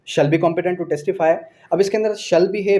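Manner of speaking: ordinary delivery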